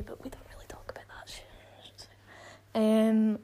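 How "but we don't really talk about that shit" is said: whispering. Real, not acted. Gossiping aside about Mum.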